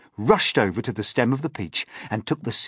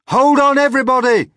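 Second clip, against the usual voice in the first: high, full-voiced shout of warning; urgent calling out; 14 semitones higher, 10 dB louder, faster than usual. James warns his friends to hold on, calling out. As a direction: call out loud to the whole crew. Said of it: "James called out".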